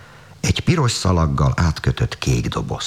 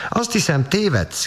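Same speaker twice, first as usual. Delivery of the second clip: put-on high, indignant little-girl cry; cried out. Tánya cries out at her brother, high and offended. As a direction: let Tánya's hurt pride ring up high. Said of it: "kiáltotta".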